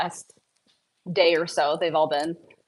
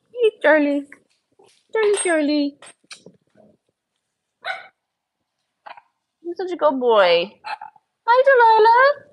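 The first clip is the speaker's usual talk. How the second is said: high sing-song pet voice; talking to a dog; 10 semitones higher, 5 dB louder than usual. Real, not acted. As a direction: coo to the puppy, high and loud. Greeting dogs in baby talk.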